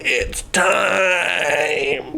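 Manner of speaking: quiet, odd hollow corpse voice; a hint of a lobotomized undead man; softly voiced zombie saying his line; creepy corpse voice. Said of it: "That's how he talks now".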